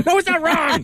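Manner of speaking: high-pitched mock-frantic denial; exaggerated high reassurance; mock protest. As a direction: squeal it in comic alarm. Host jokingly protests co-anchor's doubt.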